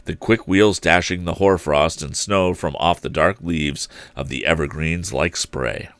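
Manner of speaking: ordinary delivery, nothing marked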